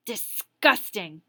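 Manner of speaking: slow, loud, drawn-out disgust. Acted. Teacher condemning the spitball, slow disgusted verdict. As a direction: let the word drip with distaste. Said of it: "Missus Wessex informed him".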